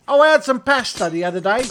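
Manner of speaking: British accent, lightly put on; mock posh English. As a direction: play a chatty Englishman, loud and high. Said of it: "English people say".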